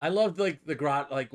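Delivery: ordinary talk, nothing marked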